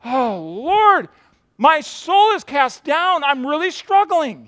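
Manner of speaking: slow, high pleading lament. Retold or imitated. David confessing struggle to the Lord, pleading. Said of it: "and he says".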